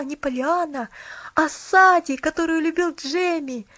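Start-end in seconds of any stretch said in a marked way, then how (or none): none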